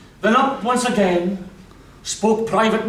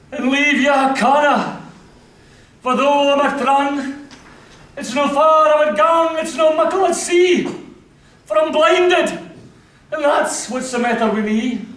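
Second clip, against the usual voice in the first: a stubborn blind private calling out; blinded Scots soldier; 7 semitones higher than usual. Acted. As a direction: refuse to leave him, voice raised.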